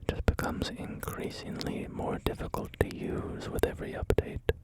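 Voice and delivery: usual speaking voice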